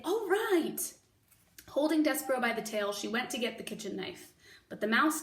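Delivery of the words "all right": Mig's voice. Acted. Mig agrees.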